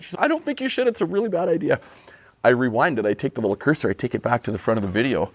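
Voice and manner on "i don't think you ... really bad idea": high, lightly voiced wife warning; wife's warning. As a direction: play the wife cautioning him.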